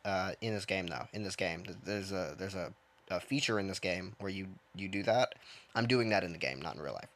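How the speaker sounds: ordinary talk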